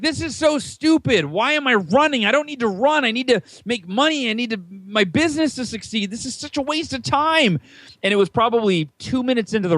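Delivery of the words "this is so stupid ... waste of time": higher-pitched, exasperated grumble; grudging complaint. Retold or imitated. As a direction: moan like a reluctant runner forced out the door. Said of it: "And I was like".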